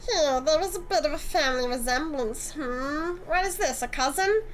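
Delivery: a lightly voiced smug, flirty antique dealer; smug Eric.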